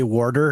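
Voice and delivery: "warder" as a way of saying water. Retold or imitated. Friend quoting people they know, added R. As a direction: sound the extra R in "warder". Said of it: "people that we know that say warder".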